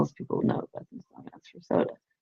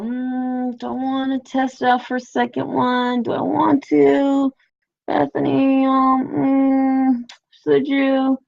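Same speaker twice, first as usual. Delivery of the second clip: tempting inner voice; 7 semitones higher, much slower than usual. Retold or imitated. Tempting inner voice urging another drink.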